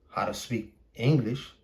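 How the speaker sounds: ordinary talk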